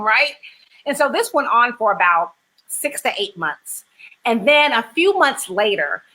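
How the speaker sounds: ordinary delivery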